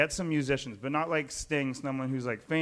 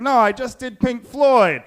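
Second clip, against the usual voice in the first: high, loud, slow protest; loud, high exasperated retort; fed-up exec; 11 semitones higher, 14 dB louder, slower than usual. Retold or imitated. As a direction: cut them off, exasperated. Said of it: "The guy's like".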